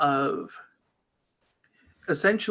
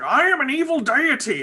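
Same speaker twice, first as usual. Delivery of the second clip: high, mock-villain Manchester accent; 11 semitones higher, 4 dB louder than usual. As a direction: ham it up as an evil god from Manchester. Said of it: "he's got a Manchester accent".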